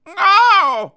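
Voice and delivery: sharp squeaky ow; high pained yelp. Acted. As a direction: yelp like a hit toy. Hit by rackets.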